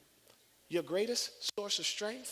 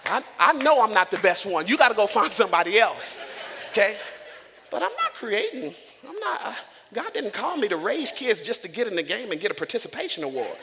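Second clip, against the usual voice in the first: loud, laughing delivery; laughing preaching; 3 semitones higher, 10 dB louder than usual. Real, not acted. Preacher laughs at himself while raising kids, loud and laughing. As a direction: laugh through the point.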